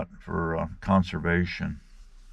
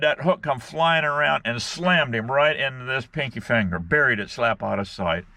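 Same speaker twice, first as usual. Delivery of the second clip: ordinary talk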